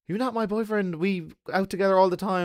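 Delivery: a hint of a bemused friend; mimicking Ling Ling. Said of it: "she was like".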